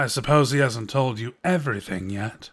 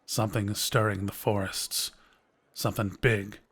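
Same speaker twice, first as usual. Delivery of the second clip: ordinary talk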